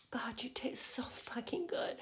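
intimate teasing whisper. Acted.

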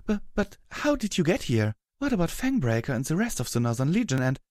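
high, stammering surprise; Rarity's startled voice. Acted. Rarity meets Hammer Chain unexpectedly, high startled voice. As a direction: pitch it up and tumble into questions.

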